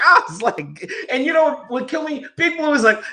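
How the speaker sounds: can barely get it out for laughing. Real, not acted. Cracking up retelling Big Boy.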